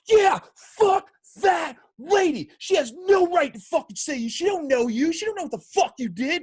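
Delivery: imitating an angry friend yelling about a woman; retold furious yell. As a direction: shout it as the raging friend. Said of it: "he is just screaming".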